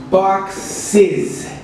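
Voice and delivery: "boxes" said as box-iz; "boxes", with a clear iz ending; extra syllable. Shown as a demonstration. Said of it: "the correct pronunciation is".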